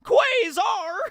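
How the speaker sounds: very high, loud startled yell; hammed-up shocked cry; shocked shout. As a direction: shriek the name in mock disbelief. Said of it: "result in you being shocked".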